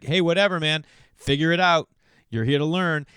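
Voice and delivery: impatient mock teacher brushing a kid off; mock dismissive. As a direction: wave them off loudly. Schools brushing kids off, acted.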